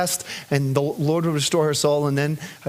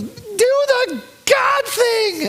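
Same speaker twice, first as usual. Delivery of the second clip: put-on child's demand; much slower than usual. Daughter demanding father's prayer, high slow shout. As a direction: insist like a determined toddler.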